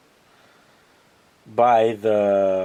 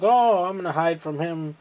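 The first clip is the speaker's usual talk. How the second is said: lightly mocked child, higher pitch; a hint of a foolish kid, pitched up; imitating dumb kid; 6 semitones higher than usual. Dumb kid hiding from the robots, lightly mocked high voice. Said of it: "he's a dumb kid".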